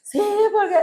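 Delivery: high wailed yes; retold tearful retort. Wife answering husband that she is angry with God, wailing. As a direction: re-enact a sobbing, defiant answer.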